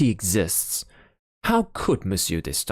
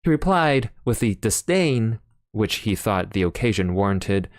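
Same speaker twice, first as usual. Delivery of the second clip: ordinary delivery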